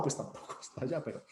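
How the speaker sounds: ordinary delivery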